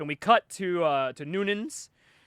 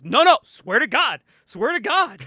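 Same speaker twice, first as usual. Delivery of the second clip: mildly hammed-up, higher-pitched insistence; louder, raised, eager insistence; insistent swearing; 6 semitones higher, 6 dB louder than usual. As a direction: act out protesting that it's true. Retold character insisting a story is true.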